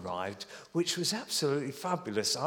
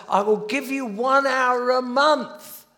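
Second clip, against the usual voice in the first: higher, louder, slow earnest promise; imagined pledge; 9 semitones higher, 7 dB louder, slower than usual. Retold or imitated. Church member promising minister, slow and earnest. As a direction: offer it like a solemn vow.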